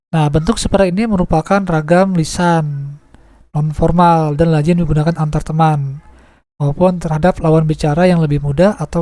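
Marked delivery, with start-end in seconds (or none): none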